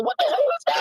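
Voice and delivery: high-pitched, laughing disbelief; retold bafflement, voice leaping way up; baffled outburst. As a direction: squeal it in comic confusion. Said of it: "I'm like".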